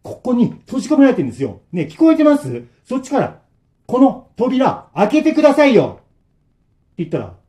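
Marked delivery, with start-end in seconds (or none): 0.0-6.0 high, loud, exasperated demand; forceful tone. Acted. Trapped friends lose patience and yell at the old man. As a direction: shout it through the door, fed up. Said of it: "つい強い口調で".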